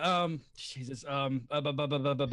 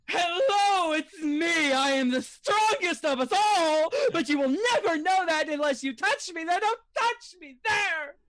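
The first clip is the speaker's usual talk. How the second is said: high, hammed-up grandiose voice; Earth Genasi; 9 semitones higher, 4 dB louder than usual. Earth Genasi boasting of strength, high and grandiose. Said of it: "Earth Genasi from the home game".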